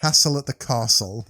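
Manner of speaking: castle said kahstle, British long ah, no rhyme with Hassle; British accent. Shown as a demonstration. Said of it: "Do it in a British accent".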